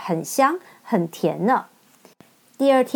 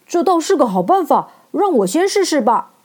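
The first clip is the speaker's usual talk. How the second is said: higher, louder eager jump-in; eager scarecrow; 5 semitones higher, 3 dB louder than usual. Acted. Scarecrow eagerly volunteers first, higher and louder. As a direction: leap at the idea. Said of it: "稻草人抢着说".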